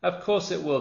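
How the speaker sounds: put-on children reassuring the girl; confident children. A narrator's reading. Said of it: "the others said".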